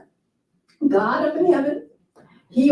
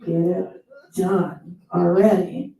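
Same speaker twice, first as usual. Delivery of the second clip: a touch lower, slow, gruff impatience; impatient lion; 5 semitones lower, slower than usual. Retold or imitated. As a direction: hurry them up like a bossy lion. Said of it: "And the lion is going".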